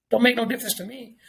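ordinary talk

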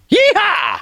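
yeehaw. Pretend. Mock cowboy whoop about Western.

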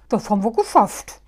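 loud, quick and delighted; beaming. Acted. Muff beaming at the others after their escape, excited. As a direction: crow about the success. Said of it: "strahlte Muff".

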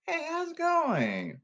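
put-on high, slow baby voice. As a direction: coo it like a little baby. Talking like a baby.

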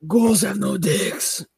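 high, slow ghoul croak. Acted. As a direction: drag it out like a ghoul. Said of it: "Your best ghoul voice".

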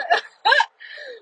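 a sudden high burst of laughter; real high laugh. Real, not acted. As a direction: laugh at the roadside people in disbelief. Laughing at people outside.

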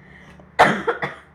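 harsh dry cough; coughing fit. Real, not acted. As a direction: cough hard, no words. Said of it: "I keep coughing".

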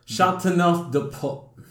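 deliberate, Frenchified "Chateauneuf de Pop"; showy French pronunciation. Shown as a demonstration. Showing the French wine name.

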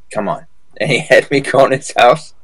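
usual speaking voice

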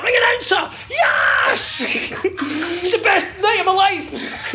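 high, laughing, slow shouted joy; excited kid. Retold or imitated. Billy imitates a little kid thrilled by the ring announcer, excited shout. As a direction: squeal it like a thrilled child. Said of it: "little kids can get".

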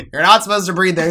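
loud mock scolding teacher; Tim. Teacher telling student off for breathing, mock scolding. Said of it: "And Tim would always go".

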